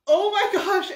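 high, loud horrified outburst; retold shock. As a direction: react aghast, re-enacting the moment. Retold shock at bed bugs.

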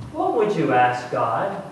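ordinary talk, nothing marked

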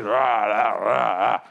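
low imitated grumbling rah rah; mock grumbling noise. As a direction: growl out the complaining crowd. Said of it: "You can just picture".

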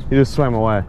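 normal talking voice

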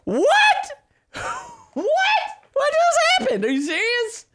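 very high incredulous squeak; stunned disbelief. Real, not acted. Gamer reacts to the ridiculous ending, in high squeaky disbelief. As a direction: squeal it in baffled astonishment.

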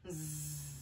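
low, soft buzzing zzz; storybook sleeping noise; imitated snoozing. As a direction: buzz softly like a sleeper. Frog asleep, imitated snore.

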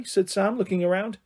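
plain reading voice

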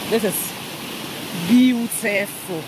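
very slow, stretched beautiful in a put-on voice; lightly voiced friend, drawn out; Maso's voice. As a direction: drag out beautiful, imitating a friend. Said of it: "In Maso's voice".

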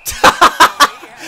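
breathless high-pitched laugh. Real, not acted. Gamer watching a botched shootout move, high breathless laugh. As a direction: burst into incredulous laughter.

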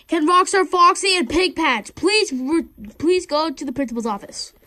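high, loud announcer; intercom announcement. Pretend. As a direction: call over the loudspeaker.

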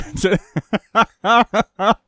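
real helpless chuckling; laughing. Real, not acted. Reacting to Roger's expertise claim, real laughter. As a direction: break up laughing. Breaks up at the vagina remark.

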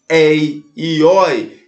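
"ei e oi" slow and open; open diphthongs. Shown as a demonstration. Teacher explains the accent rule, open diphthongs drawn out in "ei e oi". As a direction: stretch "ei e oi" with open vowels. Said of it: "os ditongos abertos".

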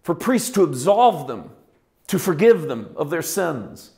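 loud, high full-force sermon; preaching. Acted. Pastor preaching hard to his congregation, full force. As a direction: preach it out to the whole room.

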